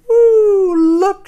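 high, loud whoop; thrilled fish squeal; excited cry. A narrator's reading. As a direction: whoop it out at the top of your voice. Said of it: "she cries".

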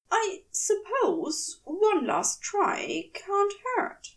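high, slow, fussy angel voice; Aziraphale's voice. Acted. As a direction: lift the voice high and draw out a hesitant offer. Aziraphale speaks, narrator voices him.

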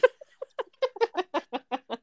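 high giggle after a burp; real embarrassed giggle; embarrassed high laugh. Real, not acted. Laughing after her own burp.

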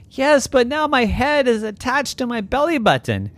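high-pitched llama complaint; upset protest. Acted. Llama complaining to Zeus, upset protest.

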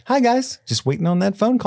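very high, timid mock voice; squeaky imitation of an awkward lurker; Misty. Voicing Misty lurking at party.

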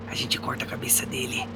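sinister whisper. Acted. The sword whispering to Shao about killing him, a sinister whisper. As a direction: hiss the threat under the breath.

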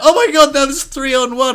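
very high, loud mock panic; panicked. Outnumbered man facing three angry mates, panicked. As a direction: cry out in acted alarm, pitched right up.

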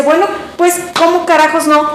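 high, exasperated swearing retort, as grandpa said it; grandpa swearing uncensored. Retold or imitated. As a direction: blurt the curse in grandpa's exasperated voice. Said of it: "Tú, tú di como dijo tu abuelito". (el abuelo, harto de la insistencia, suelta la grosería exasperado)

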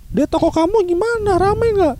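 high-pitched worried mother; mom's voice. Retold or imitated. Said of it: "mak gua langsung".

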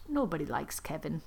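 low, hushed aside; quiet confiding. Acted. Narrator voices chef confiding in Tracy, quiet and low. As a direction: drop down soft and low.

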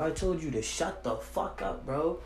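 ordinary delivery

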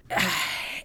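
heavy breathed-out sigh; exasperated sigh. Real, not acted. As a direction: sigh out frustration. Frustrated at grunge history.

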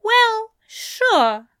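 high-pitched reluctant agreement; conceding. A narrator's reading. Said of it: "Aziraphale conceded".

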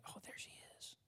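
hushed gossip's whisper. Acted. Gossip to friend as the person walks in, furtive whisper. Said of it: "one of y'all will start whispering".

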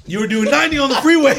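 high, loud outburst; incredulous yell; loud disbelief. Real, not acted. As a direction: exclaim it high and loud at your friend. Friend exclaims over the retold danger.

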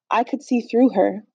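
usual speaking voice

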